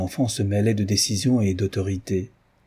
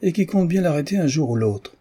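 higher, self-important assertion; puffed-up pride; 7 semitones higher than usual. A narrator's reading. Inspector boasting he will catch Lupin, puffed up. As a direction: swell with pride on the words. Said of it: "dit l'inspecteur en se rengorgeant".

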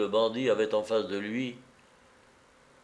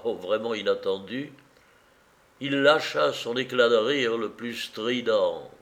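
usual speaking voice

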